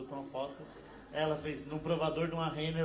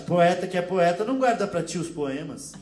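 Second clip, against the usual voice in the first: louder, slow Portuguese rebuttal; mock Portuguese accent; 7 dB louder than usual. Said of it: "comentou embaixo".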